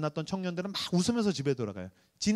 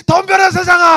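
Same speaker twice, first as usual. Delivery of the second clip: much higher, loud battle cry; defiant shout; 14 semitones higher, 15 dB louder than usual. Retold or imitated. Pastor shouting defiance at the world as he heads out.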